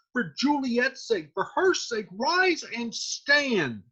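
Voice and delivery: high, slow, drawn-out urging; pleading exhortation. Acted. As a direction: urge him up, stretching the words. Nurse exhorts weeping Romeo.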